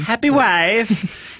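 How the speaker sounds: slow high sing-song chant. Acted. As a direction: stretch it out high and singsong. S0 sings catchphrase high slow.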